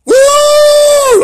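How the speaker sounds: very high, loud whooping yell; Ric Flair woo. Retold or imitated. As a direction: let out a wrestler's victory whoop. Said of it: "Like Ric Flair says".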